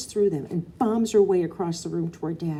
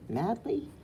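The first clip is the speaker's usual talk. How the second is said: ordinary delivery, nothing marked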